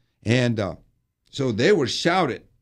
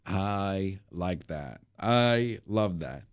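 ordinary talk

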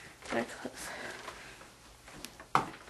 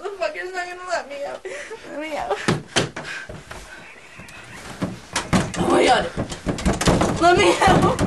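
higher, louder desperate pleading with sobs and laughs; pleading panic; 5 semitones higher, 5 dB louder, faster than usual. Real, not acted. Locked in the haunted room, begging to be let out. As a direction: beg the room to let you out.

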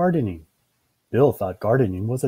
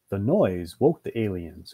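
usual speaking voice

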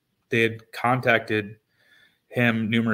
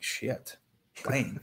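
much softer, under the breath; muttered curse; 9 dB quieter than usual. Retold or imitated. Player dreading a surprise game, muttered softly. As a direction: swear quietly to yourself in dread. Told he plays today unprepared.

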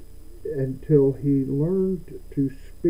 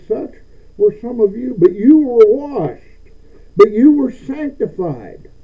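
preaching; 6 semitones higher, 6 dB louder than usual. Acted. Pastor preaching at full force.

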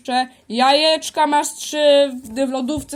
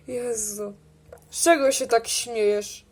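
ordinary talk

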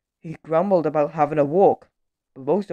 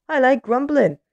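dawning realisation; 8 semitones higher than usual. A narrator's reading. Grumbler's sudden happy realisation.

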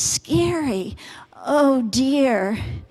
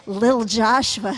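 ordinary delivery

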